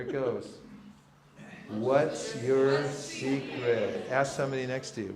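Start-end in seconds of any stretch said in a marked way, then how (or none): 1.7-4.1 drawn out, very slow and weighty. Shown as a demonstration. Teacher revealing the top mantra, very slow. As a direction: stretch each word out, slow. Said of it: "Shall I show it".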